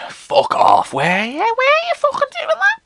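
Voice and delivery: high incredulous shriek; outraged mother. Retold or imitated. Mother reacting to the school's prayers, high-pitched outrage. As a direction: squeal it in disbelief.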